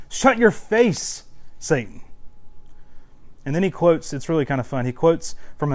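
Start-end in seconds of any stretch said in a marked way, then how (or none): 0.1-2.0 slow, punchy, higher-pitched put-down; mock rebuke. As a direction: tell Satan off with playful force. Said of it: "but he says".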